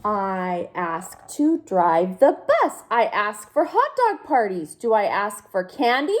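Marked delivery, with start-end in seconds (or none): none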